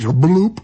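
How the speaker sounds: comic stomach gurgle sound; mock bloop. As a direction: pop the bloop out like a burp rising. Borscht rising in his stomach.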